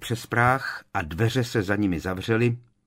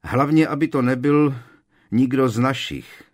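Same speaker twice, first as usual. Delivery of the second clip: raised, louder voice of concern; anxious, pitched-up interjection; worried; 5 semitones higher, 6 dB louder than usual. Acted. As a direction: voice a fear for friends, higher and louder. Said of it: "vyslovil obavu čísi hlas".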